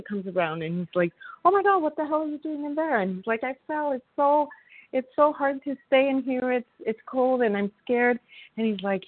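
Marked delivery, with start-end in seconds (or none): 1.4-3.0 much higher, startled exclaim; shocked doctor. Retold or imitated. Doctor finding a man in a hole, higher shocked exclaim. 3.4-8.2 higher, imitating a frightened trapped man; scared pleading. Man stuck in the hole answering the doctor, higher frightened plea.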